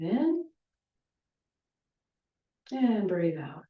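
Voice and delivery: soft, unhurried breathing instructions; a lightly softened breath-count voice; guided breathing cues. Acted. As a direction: talk them gently through each breath.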